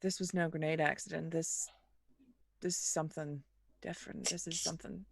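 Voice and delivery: quiet, low and uneasy; hushed, low muttering. Acted. Character muses over body, hushed.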